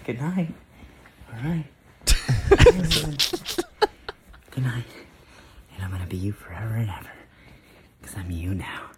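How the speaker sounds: unsettling whisper into the camera; creepy whisper. Acted. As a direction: whisper it like a stalker in the dark. Creepy whispered video ends.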